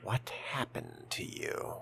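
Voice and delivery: ordinary talk, nothing marked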